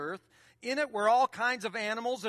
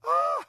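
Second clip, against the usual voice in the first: shocked parent gasp; 5 dB louder than usual. Retold or imitated. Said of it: "You're gonna go".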